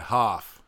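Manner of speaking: "half" with a broad open "ah"; Boston "half". Shown as a demonstration. Giving a Boston keyword, "half" with broad ah. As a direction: say "half" as "hahf". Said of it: "it's not half, it's half".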